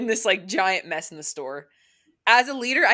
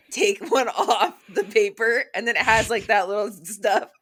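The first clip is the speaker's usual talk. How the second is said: laughing through the words; can't stop giggling while asking; real giggles, louder and higher; 3 semitones higher, 5 dB louder than usual. Real, not acted. Giggling about licking roll crumbs.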